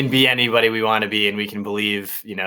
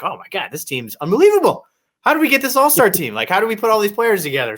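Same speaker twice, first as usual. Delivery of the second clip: mock giddy fan; 9 semitones higher, 3 dB louder than usual. Fan to podcast cohosts, hammed-up excited gushing. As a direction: pitch leaping higher, gushing over the roster. Retelling his own preseason hype.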